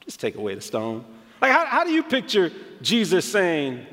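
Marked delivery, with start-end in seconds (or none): 0.0-1.0 much lower and softer, gently; calm and quiet. Shown as a demonstration. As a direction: say it softly, almost to yourself. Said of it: "or if he was kinda like".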